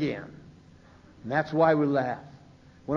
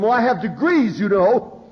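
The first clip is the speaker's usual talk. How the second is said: indignant boast; 5 semitones higher, 5 dB louder than usual. Retold or imitated. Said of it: "I told him".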